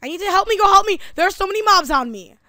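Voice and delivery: high, loud panicked cry for help; frantic gamer yelling for help; panicked plea. Real, not acted. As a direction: shout for help as the mobs swarm you. Swarmed by mobs in the game.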